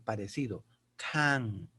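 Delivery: can said with a short open a like Spanish a; can with the a between two consonants, short and open; English can. Shown as a demonstration. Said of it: "el sonido de la vocal es parecido a la vocal española".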